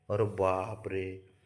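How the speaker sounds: slow, hushed mock shock; mock dismay. Man reacting to pet's mischief, mock dismay. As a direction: draw it out softly, as if appalled. Reacting to the dog's supposed misdeed.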